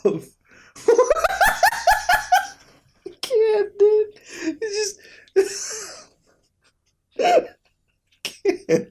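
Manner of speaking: high, squeaking, crying with laughter; can barely get the words out for laughing; helpless laughter. Real, not acted. Vlogger in tears laughing at a picture, wheezing laughter. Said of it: "I'm gonna tear up right now".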